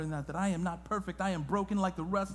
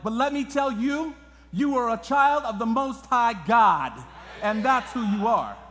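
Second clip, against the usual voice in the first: loud, rising proclamation; preaching at full force; 3 semitones higher, 8 dB louder than usual. Acted. Pastor proclaiming to the congregation, full force. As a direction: declare it over the crowd.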